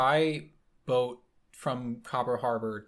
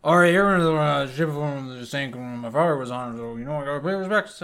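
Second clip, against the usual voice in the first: put-on loud gruff old boat captain paying respects; old sailor; 3 semitones higher, 9 dB louder than usual. Old sailor telling the players he must honor his father. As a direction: boom it out as the old sailor at the lake. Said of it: "slows down and he says".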